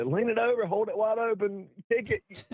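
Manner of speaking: high-pitched teasing call, near laughter; laughing. Retold or imitated. Dad riding past his stalled son, laughing call. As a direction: call out instructions high, amused. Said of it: "coming around him laughing".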